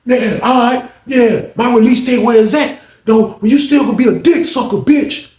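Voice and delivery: high, heated mock comeback; acted angry taunt. Inmate insulting officer over his release date, angry. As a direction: jeer back at her as the inmate.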